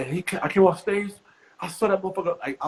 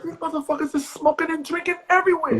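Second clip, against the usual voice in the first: indignant; 14 semitones higher than usual. Retold or imitated. He argues back indignantly.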